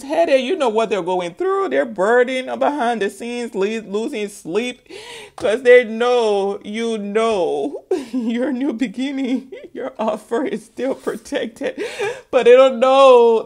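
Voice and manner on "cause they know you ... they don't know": words broken up by laughter; laughing while talking. Real, not acted. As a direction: keep going through the giggles. Laughs through the reading.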